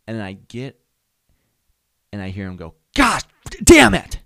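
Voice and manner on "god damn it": loud, high, drawn-out furious yell; yelling at himself. Retold or imitated. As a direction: explode at yourself in an acted outburst. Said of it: "he was yelling at himself".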